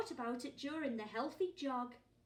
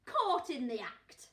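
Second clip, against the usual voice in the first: loud, slow, triumphant; loud accusation; 2 semitones higher, 10 dB louder than usual. Acted. Mister Large catches his wife sneaking cake, loud and slow. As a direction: nail the culprit with each word. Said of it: "said Mister Large".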